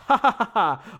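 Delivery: loud, high acted ha-ha; mock hearty laugh. Russian reviewer laughing over brother's letter, staged laugh. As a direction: belt out a staged laugh in character. Scripted laugh inside accented character reading.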